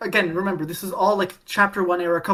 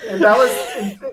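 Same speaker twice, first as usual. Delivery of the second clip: laughing; 3 dB louder, slower than usual. Real, not acted. Laughing about the twist.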